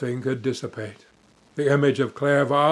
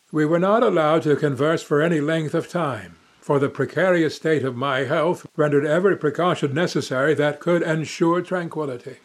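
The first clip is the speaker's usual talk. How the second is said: ordinary delivery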